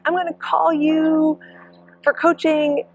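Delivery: calling out like a fan on the course; mock cheering fan. Spectator calling out.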